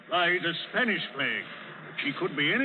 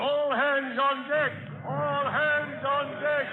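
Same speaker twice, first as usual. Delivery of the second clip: high, slow shouted alarm; shouted order; 9 semitones higher, slower than usual. Acted. Captain rousing the crew as pirates attack, slow high shout. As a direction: bellow it across the whole deck.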